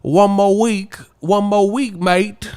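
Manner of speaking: high, hammed-up British accent; mock British fan. Mocking British fans' catchphrases, high British accent. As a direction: parody an excitable British fan. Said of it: "And the British teeth be like".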